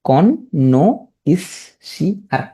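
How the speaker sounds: "কন", "ন", "ইস", "সি আর" each alone; syllable-by-syllable spelling. Shown as a demonstration. Teacher breaking the spelling down, piece by piece. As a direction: pause after every piece, "কন" to "সি আর". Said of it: "উচ্চারণ করবে কি হবে".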